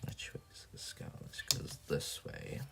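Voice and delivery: a whispered puzzled question; quiet whispered thinking aloud; whispered to self. Real, not acted. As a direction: whisper it as you work out the fold. Figuring out paper fold aloud.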